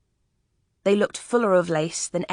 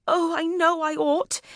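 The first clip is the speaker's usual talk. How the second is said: higher lady's voice crying out in distress; 10 semitones higher than usual. A narrator's reading. The memsahib crying out to the officer, higher and distressed. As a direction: wail it as the frightened young mother. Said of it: "she cried".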